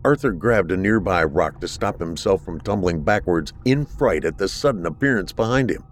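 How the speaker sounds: ordinary delivery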